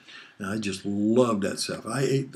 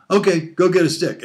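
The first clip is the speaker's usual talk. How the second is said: louder, higher stern command; angry mom order; 7 semitones higher, 8 dB louder than usual. Retold or imitated. Mother punishing her son, a loud stern order. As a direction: order him out like a fed-up mother. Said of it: "And she said".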